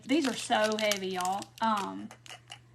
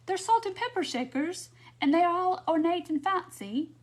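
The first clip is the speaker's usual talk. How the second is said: higher-pitched, hammed-up British accent; attempted British accent; 6 semitones higher than usual. Crafter playfully describing ornate shakers to viewers, attempted British accent. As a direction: put on an amateur British accent. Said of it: "Trying to talk in my best British accent".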